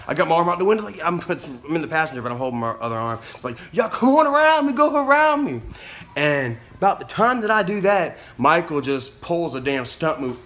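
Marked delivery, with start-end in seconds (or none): 3.7-5.6 high, loud retold holler; calling out. Passenger hollering to drivers behind, high and loud. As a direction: wave traffic past with a raised voice.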